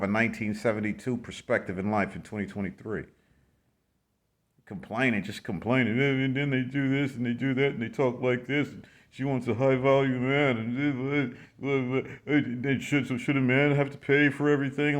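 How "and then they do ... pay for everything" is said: mock whining man. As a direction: poke fun at a man who keeps griping. Mocking men who complain online.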